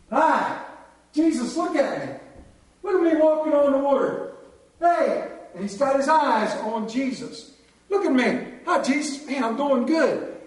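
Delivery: put-on bragging like a kid on a bike, look at me; boastful Peter. Peter showing off on water.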